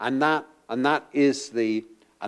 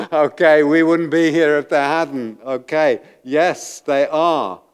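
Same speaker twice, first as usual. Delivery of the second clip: ordinary talk, nothing marked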